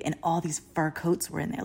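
hushed whisper as if revealing a secret. Retold or imitated. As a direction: whisper it like you've stumbled on something hidden. Secret fur room discovered.

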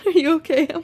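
lightly voiced manager checking in; concerned manager. Imitating her manager on the phone, lightly concerned.

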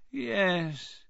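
drawn-out, high and falling musing; faraway old wizard's yes; dreamy. A narrator's reading. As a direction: let the word float away dreamily. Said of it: "said Dumbledore dreamily".

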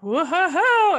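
loud, high mock crowd "whoa"; imitated audience whoop. Host imitating the audience's reaction to a picture, mock whoop. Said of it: "everyone in the audience was like".

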